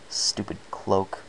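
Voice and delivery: ordinary delivery, nothing marked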